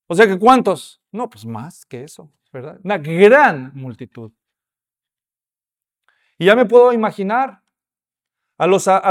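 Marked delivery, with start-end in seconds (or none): 2.8-4.3 slow "Una gran multitud" with "gran" leaned on; weighty slow emphasis; stress on gran again. Shown as a demonstration. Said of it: "no dicen que era una multitud".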